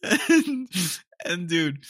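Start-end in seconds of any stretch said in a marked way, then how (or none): 0.0-0.7 laughing. Real, not acted. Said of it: "Don't know if I can get through this".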